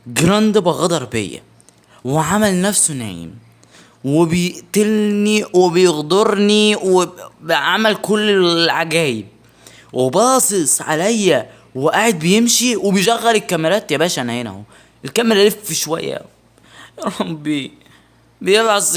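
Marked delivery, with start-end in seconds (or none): 4.0-13.9 slow indignant rant. Real, not acted. Ranting about the betrayal.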